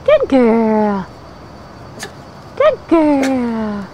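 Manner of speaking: reward the puppy softly; praise. Real, not acted. Owner praising puppy gently.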